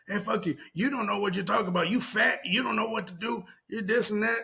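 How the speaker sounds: high-pitched mock rant, quick on the middle line; mock angry woman. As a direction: spit it out like a scorned caller. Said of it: "she's like".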